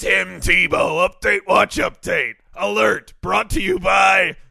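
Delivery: loud announcer shout, slowed; shouted news alert. Acted. Host echoing the news alert in a loud shout. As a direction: bark the alert like a radio drop.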